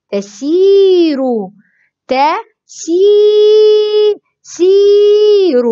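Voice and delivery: تسير slowly, with a long held see; elongated ee. Shown as a demonstration. Teacher models madd with yaa, vowel stretched. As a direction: build to a high, sustained ee in سير. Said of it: "مد بالياء".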